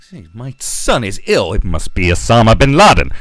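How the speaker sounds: hammed-up paranoid yelling, blaming Osama; panicked paranoid citizen.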